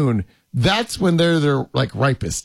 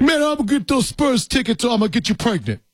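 Tracy Morgan impression; 8 semitones higher than usual. Host doing his Tracy Morgan bit for his co-host. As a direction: do your best Tracy Morgan. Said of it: "my Tracy Morgan impersonation".

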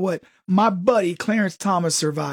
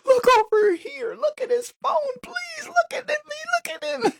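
very high, pleading mock falsetto cry; desperate wife. Wife begging people to check his phone, falsetto plea. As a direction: shriek for attention in falsetto. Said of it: "the wife ... going".

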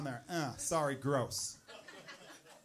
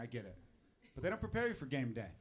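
usual speaking voice

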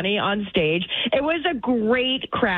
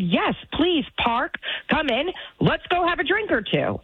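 higher-pitched, eager re-enacted invite; eager invitation; 6 semitones higher than usual. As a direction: coax them along in a raised, lightly acted voice. Retells inviting the driver along.

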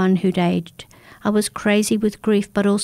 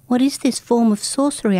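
higher, alarmed cry of disbelief; cried out; 4 semitones higher than usual. A narrator's reading. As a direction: cry out in horror at the sorcery. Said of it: "I cried".

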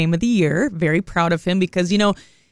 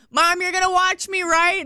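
imitating her son; 10 semitones higher than usual.

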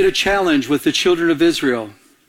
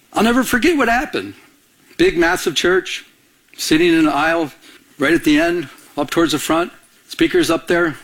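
ordinary talk

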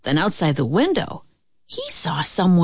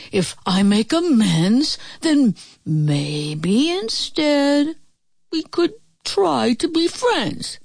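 halting, higher wolf plea; panting; 6 semitones higher, slower than usual. A narrator's reading. Wolf offers friendship from the chimney, halting mild pant. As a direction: draw it out as if mildly puffed. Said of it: "the wolf panted".

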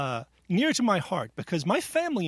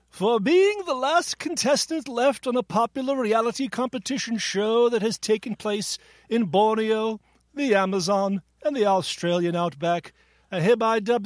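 regal voice; 3 semitones higher than usual. Acted. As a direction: proclaim it like a king knighting someone. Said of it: "I will be using this regal voice for the entire quiz".

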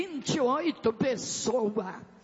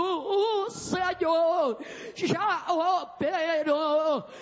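fervent revival preaching; 5 semitones higher than usual. Real, not acted. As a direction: preach with forceful, stretched-out words to rouse the church. Preaches at full force to church. (pregação pentecostal fervorosa)

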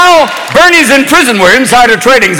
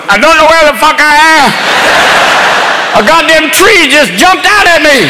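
slow, higher tipsy machine voice; drunk GPS; 5 semitones higher, slower than usual. Pretend. Drunk car GPS telling the driver it's lost, slow and tipsy. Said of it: "the G P S in the car is going".

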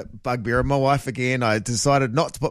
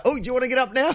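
lightly acted, higher-pitched nudge to wake; body's wake-up voice; 8 semitones higher, much faster than usual. Body nudging its owner at weekends, higher mock wake-up voice. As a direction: pipe up as the body's alarm clock. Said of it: "it still does that".

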